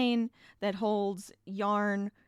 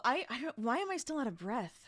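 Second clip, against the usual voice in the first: normal talking voice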